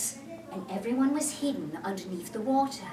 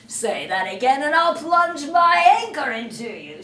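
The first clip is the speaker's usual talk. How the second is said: 1 mock Hook threat; 4 semitones higher, 9 dB louder than usual. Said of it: "laughing his head off".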